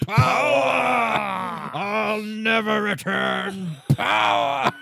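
high, drawn-out theatrical shout; wailing, over-the-top yell; hammed-up villain cry. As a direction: bellow it like a melodramatic villain. Hammed-up villain during dramatic-effect scene.

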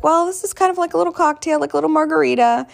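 high, fast, breezy doctor voice; lightly voiced chirpy doctor; imitating anesthesiologist. Anesthesiologist quoted.

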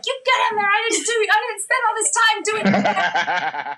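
high, bossy mom voice; stern mom. Retold or imitated. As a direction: scold like an exasperated mom. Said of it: "my mom's like".

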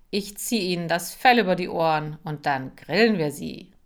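loud, brash bully voice. Acted. Boris loudly boasting to Marcel about grilling the pets. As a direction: show off loudly to his friend.

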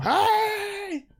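high-pitched mock yell of the older relatives; imitating a yell. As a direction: yell Hey like an old relative at a Zoom call. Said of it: "the older people just yelling at Zoom".

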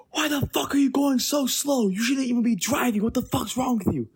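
high-pitched mock rant; angry driver. Storyteller imitating the old driver who blocked the car, high angry rant.

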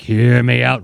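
lightly imitated announcer booming an order; mock commanding announcer voice; announcer-style command. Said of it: "It's like radio announcer voice".

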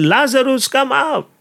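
high, calling "Lazarus, come out!" to the tomb; Jesus shouting. Retold or imitated. Jesus calling Lazarus out of the tomb, a high mock shout. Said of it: "then Jesus shouted".

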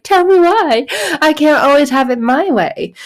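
high, petulant whine; mock whining at not getting my way; whiny complaint. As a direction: complain like a sulky kid denied. Answering the song, frustrated.

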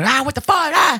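high frustrated yell; screaming at the TV. Retold or imitated. Dad yelling at the TV during the game, high frustrated shout. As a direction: let it rip at the screen. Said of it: "I'll be screaming".

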